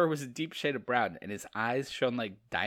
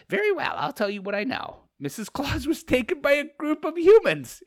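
high, louder squeaky creature voice; 14 semitones higher, 8 dB louder than usual. Acted. Creature revealing the kidnapping, squeaky and loud. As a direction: pipe it up like a little monster. Creature's line read aloud.

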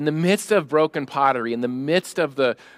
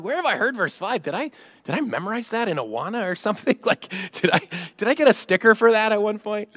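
quick, higher-pitched mock puzzlement; puzzled recollection; 7 semitones higher, faster than usual. Pastor voicing a listener trying to place a verse, puzzled. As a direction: play the listener racking their memory, fast.

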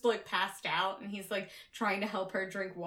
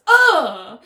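a sudden, much louder cartoon grunt; mock pained grunt; 2 semitones higher, 16 dB louder than usual. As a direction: bark out a hammy fall grunt. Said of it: "you gotta make a sound".

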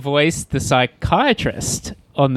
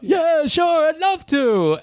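eager Mel Brooks impression; slower than usual. Mel Brooks voiced eagerly agreeing.

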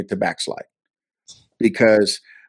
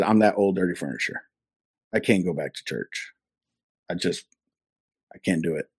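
much softer, lower, defeated confession; quiet despair; 4 semitones lower, 7 dB quieter than usual. Retold or imitated. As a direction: let the shame pull your voice down.

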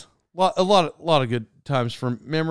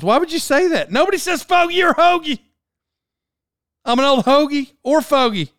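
much higher, louder incredulous complaint; exasperated outburst; 10 semitones higher, 4 dB louder than usual. Real, not acted. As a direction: throw up your hands in disbelief. Exasperated rant about wife.

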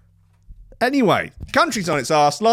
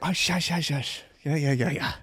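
hushed, softer shushing; hushing husband; 5 semitones lower, 8 dB quieter than usual. Pretend. Husband hushing his wife over the app, softly. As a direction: hush the wife quickly.

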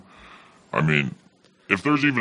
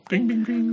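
bell imitation. Shot call bell.

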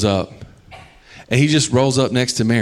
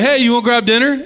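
eager invitation; 12 semitones higher, 3 dB louder than usual. Acted. Disciples call after departing Jesus.

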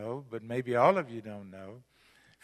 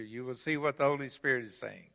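ordinary talk

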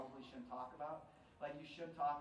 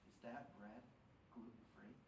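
hushed, much softer whisper; 5 semitones lower, 11 dB quieter than usual. Retold or imitated. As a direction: lean in and whisper the question. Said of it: "he whispers to me".

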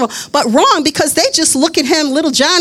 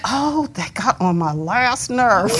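weary exasperation; 4 semitones lower, 6 dB quieter, slower than usual. Retold or imitated. Exasperated complaint acted out.

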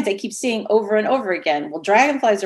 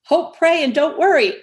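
"Hope, pray, and don't worry" said higher and louder, pushed out with conviction; emphatic motto; 7 semitones higher, 3 dB louder than usual. Retold or imitated. Urging a worried stranger at the door, emphatic and uplifting. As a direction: preach the motto at him brightly. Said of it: "Do you know Padre Pio?".

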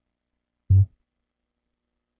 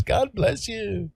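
mock well-wisher; 11 semitones higher, 6 dB quieter, slower than usual. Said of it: "while everybody says".

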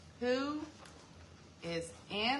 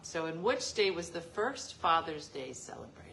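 normal talking voice

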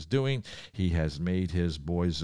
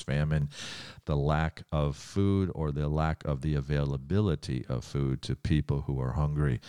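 ordinary talk, nothing marked

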